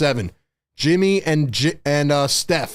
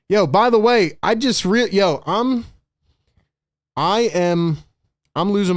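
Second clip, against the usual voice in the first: excited outburst; 5 semitones higher than usual. Real, not acted. Streamer losing mind over game.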